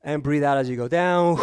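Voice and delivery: calm cool-down guide. Acted. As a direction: ease them down gently. Leads the cool-down breathing.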